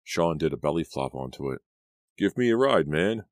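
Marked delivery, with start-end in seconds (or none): 2.2-3.2 playful, high call; calling out, grinning. A narrator's reading. Sean on the sled calling to Ivan, playful. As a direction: call up cheekily with a grin. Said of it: "Sean called, grinning up at Ivan".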